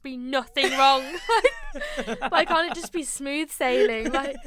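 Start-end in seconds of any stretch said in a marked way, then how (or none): 1.2-4.4 laughing rant. Real, not acted. Laughing rant about films.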